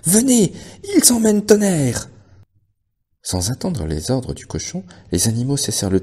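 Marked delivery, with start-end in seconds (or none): 0.0-2.1 high urgent yell; alarmed shout. A narrator's reading. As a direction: call everyone over in panic. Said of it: "cria-t-il".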